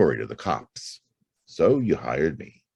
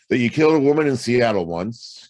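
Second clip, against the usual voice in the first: higher, louder accusation; accusing; 9 semitones higher, 6 dB louder than usual. Acted. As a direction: drive the charge home, voice rising. Accusing her of murder.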